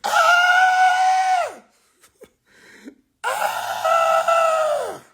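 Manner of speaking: very high shrieking laugh cry. Real, not acted. Cracking up at the comments, high shriek. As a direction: let out a piercing laughing scream.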